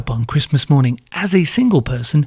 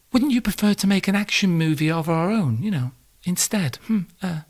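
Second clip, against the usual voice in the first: higher, soft coaxing wife voice; as the wife, coax him softly and higher; 4 semitones higher, 6 dB quieter than usual. Acted. Wife voiced in couple sketch.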